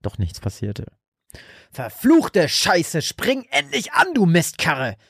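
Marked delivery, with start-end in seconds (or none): 1.7-5.0 higher, slow furious swearing; father cursing his truck that won't start, furious; furious roar. A narrator's reading. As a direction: curse the dead truck. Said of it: "brüllte Frank".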